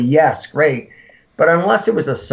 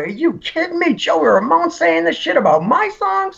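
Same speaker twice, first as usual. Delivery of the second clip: much higher, thrilled squeal of disbelief; giddy disbelief; 14 semitones higher than usual. Retold or imitated. Guest relives hearing Joey praise his songs, high giddy disbelief. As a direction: sound starstruck and amazed. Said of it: "And I'm like going".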